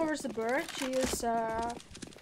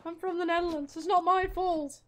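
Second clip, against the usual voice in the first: higher, mock-indignant protest; exasperated defense; 5 semitones higher, 4 dB louder than usual. Real, not acted. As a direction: whine it's not my fault, half joking. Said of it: "What is his accent?".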